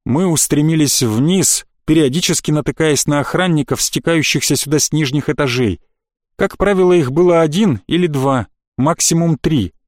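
usual speaking voice

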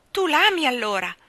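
high, loud cry of discovery; Ermanno cries out. Acted. Ermanno crying out to the fairy, high and loud. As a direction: burst out, amazed and loud. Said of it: "gridò Ermanno".

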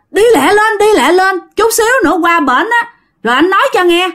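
high, fast, pressing; husband hurrying his wife onto the motorbike, urgently. Retold or imitated. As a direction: rush her out the door. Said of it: "ổng gấp gáp dữ lắm".

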